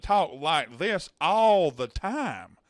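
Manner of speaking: slow, stretched-out Southern drawl; affected Southern accent. Shown as a demonstration. Showing how hometown people talk, affected Southern drawl. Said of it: "I obviously just affected my voice".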